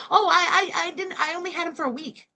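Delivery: high-pitched, quick laughing imitation; mocking cackling excuse. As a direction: mimic her giggling through a hurried excuse. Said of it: "Sitting there cackling 'cause".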